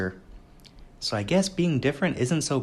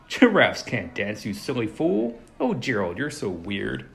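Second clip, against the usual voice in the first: sneering; slower than usual. Acted. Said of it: "the animals all sneered".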